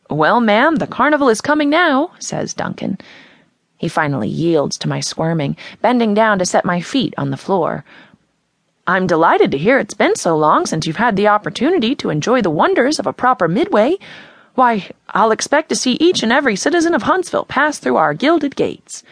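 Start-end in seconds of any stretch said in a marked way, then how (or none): 0.1-2.1 put-on friendly Midwestern accent. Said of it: "The friendly Midwestern accent is gone". 8.9-19.0 higher, showman's Midwestern pitch; friendly Midwestern accent. Acted. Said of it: "The friendly Midwestern accent is gone".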